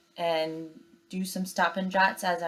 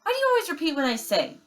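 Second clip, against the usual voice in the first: high, fast, exasperated; annoyed sister; 10 semitones higher, 4 dB louder, much faster than usual. Acted. Sister bear to her echoing brother, high fast and exasperated. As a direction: snap at him, fed up.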